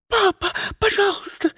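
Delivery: very high, shrill plea; terrified squeal. A narrator's reading. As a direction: squeal in horror. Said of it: "завизжала в ужасе".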